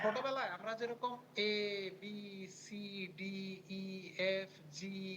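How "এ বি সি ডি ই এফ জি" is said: put-on slow, stretched childhood recital of এ, বি, সি; letters sung out long and slow; drawn-out letters. Shown as a demonstration. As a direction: drag each letter out like a child. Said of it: "এরকম টেনে, টেনে পড়ছি".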